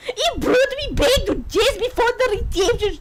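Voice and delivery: high attempted Scottish accent, close to laughing. Bride tells of going back, in a high mock Scottish accent. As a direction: keep up the mock Scot while amused. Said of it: "could do a Scottish accent".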